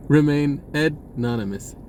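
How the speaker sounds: normal talking voice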